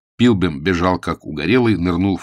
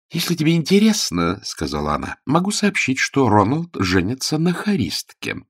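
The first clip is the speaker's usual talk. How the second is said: cold sarcasm; 7 semitones higher than usual. A narrator's reading. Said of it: "стала язвительной и холодной".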